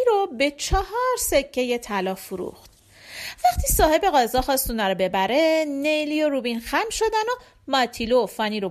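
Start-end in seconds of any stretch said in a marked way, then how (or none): none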